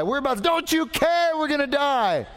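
high-pitched frantic outcry; heightened panic. Acted. Disciples crying out to Jesus in the storm, escalating panic. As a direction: cry out in acted panic, cutting yourself off. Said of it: "Maybe it heightens".

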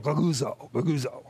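low grumbled goguzo, goguzo, like a crowd muttering; low complaining murmur of goguzo; muttered grumbling sound. Shown as a demonstration. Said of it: "Doesn't it sound just like that?".

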